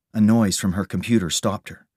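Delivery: plain reading voice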